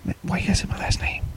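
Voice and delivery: whispered mock complaint; whispering. Real, not acted. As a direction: whisper it in protest.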